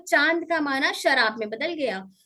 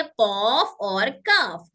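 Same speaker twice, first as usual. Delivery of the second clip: कौफ और काफ drawn out very slowly, deep कौफ set against front काफ; slow contrast; 4 semitones lower, much slower than usual. Shown as a demonstration. Teacher recapping the two letters for children, slow and deliberate. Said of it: "इस वाले हिस्से से हमने दो हरूफ सीखे".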